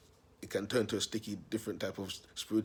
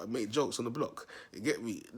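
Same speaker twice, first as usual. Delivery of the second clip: normal talking voice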